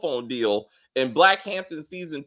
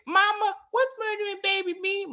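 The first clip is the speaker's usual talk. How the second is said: very high, squeaky little-kid impression; mock a grown woman talking like a tiny child; exaggerated baby-voiced question; childish voice. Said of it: "why are you talking like this? You're twenty-five".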